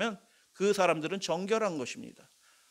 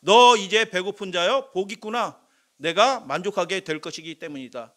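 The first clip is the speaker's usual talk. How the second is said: Jesus proclaiming; 5 dB louder than usual. Acted. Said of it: "예수님은 이렇게 말씀하신".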